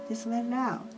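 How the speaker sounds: usual speaking voice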